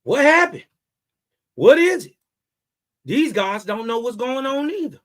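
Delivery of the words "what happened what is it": loud, higher panicked questions; hammed-up panicked "What happened?"; mock alarmed asking. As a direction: play the confused followers. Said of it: "they asking".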